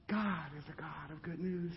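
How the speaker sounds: ordinary talk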